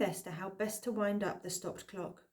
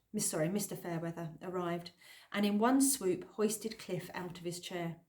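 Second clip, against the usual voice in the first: plain reading voice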